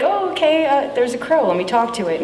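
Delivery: imitating her own surprise, then calming; mock surprise. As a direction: act out a startled reaction to spotting a crow. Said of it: "I was like".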